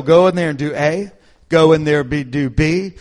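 loud, spelled-out orders; mock orders. Mimicking bosses barking step-by-step orders. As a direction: bark the steps like a checklist boss.

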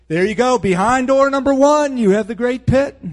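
high-pitched sarcastic prize reveal; hammed-up game-show presenter; mock game-show host. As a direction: announce a booby prize like a host.

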